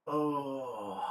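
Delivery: straining mid-stretch, soft; groaning through a stretch. Real, not acted. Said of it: "Sorry, I'm stretching again".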